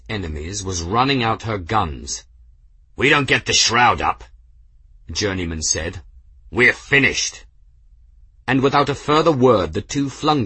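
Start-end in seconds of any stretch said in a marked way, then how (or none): 2.9-4.2 louder, urgent warning; grim urgency at raised volume; dire warning. A narrator's reading. Dire warning as guns run out. 6.5-7.4 louder, emphatic conclusion; grim, raised-volume verdict; dire warning. A narrator's reading. Dire warning as guns run out.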